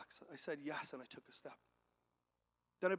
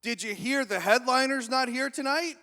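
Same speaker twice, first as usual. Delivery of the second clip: high, loud, rising question; loud stranger's question; 7 semitones higher, 12 dB louder than usual. Retold or imitated. Bathroom stranger asking about the missing headliner, calling out. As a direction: call out the gossip, pitched up.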